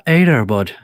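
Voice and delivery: loud hammed-up Irish accent. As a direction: boom it out in a big Irish brogue. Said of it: "You do the best Irish accent in here".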